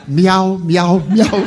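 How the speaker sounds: high, loud meowing answer with a laugh; mock cat meow. Waiter answering the hissing customer, loud high mock meow. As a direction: meow back like a cat, playfully.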